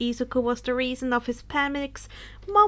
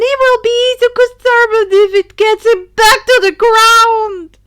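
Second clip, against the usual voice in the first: louder and higher, frantic narration; rising panic; 8 semitones higher, 14 dB louder than usual. A narrator's reading. Narrator voicing Denki's terror in the sky, frantic panic. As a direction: voice climbing in terror as the storm builds.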